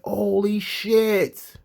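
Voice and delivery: slow, drawn-out shocked exclamation; stunned. Retold or imitated. Fan retelling the heel turn, slow stunned gasp. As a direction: stretch it out in disbelief. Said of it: "I was like".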